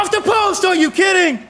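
high-pitched disbelieving sportscaster cry; excited play-by-play outburst; stunned excited call. Real, not acted. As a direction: react in real disbelief to a near miss. Commentator reacts to shot hitting post.

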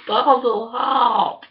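loud, high call for Barbara, drawn out; calling for help. Real, not acted.

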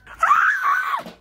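sudden high-pitched shriek of fright; shrill scream. Acted. Startled dad sees the drain, screaming high. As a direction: scream like the drain just jumped at you.

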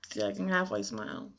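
talking around a swollen lip; with a sore jaw. Real, not acted. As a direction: sore mouth, careful speech. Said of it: "Hurts to smile, to talk".

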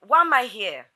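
loud, high angry shouting; furious outburst. Acted. Woman to fiance who is leaving her behind, furious shouting. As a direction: yell it indignantly, hitting each question hard. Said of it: "I'm mad at you".

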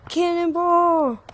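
slow stretched high yell; cannonball yell. Real, not acted. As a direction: shout it mid-jump. Jumping into the pool again.

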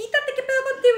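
high-pitched, mock-annoyed push-back; acted irritated protest. Woman telling man to back off, high mock irritation. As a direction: shoo him off in a high voice.

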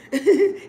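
a sudden high laugh bursting out; high burst of laughter. Real, not acted. Streamer cracking up at a viewer's channel name, laughing. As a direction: laugh out loud at the funny name.

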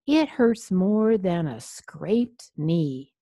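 loud, drawn-out storybook complaint; mild scream. A narrator's reading. Vampire with a hurting tooth, slow mild scream. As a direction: wail it slowly, without full screaming. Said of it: "he screamed".

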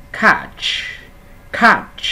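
"Catch" high and falling, with a released hissing ch; đọc mẫu. Shown as a demonstration. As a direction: model "Catch" clearly, puffing the final ch. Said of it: "Ở đây mình đọc mẫu nha". ("Catch" giọng cao, bật hơi ch cuối)

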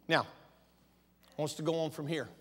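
ordinary talk, nothing marked

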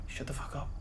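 barely audible whispered curse; tense whisper. Real, not acted. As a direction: whisper it so nothing hears you. Hushing while hiding in fear.

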